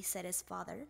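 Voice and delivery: ordinary delivery, nothing marked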